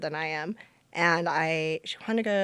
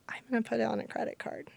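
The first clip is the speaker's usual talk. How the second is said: fast, hushed confession; whispered; 4 semitones higher, 5 dB quieter, faster than usual. Retold or imitated. Wife telling her husband through tears, whispered. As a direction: rush it out under the breath.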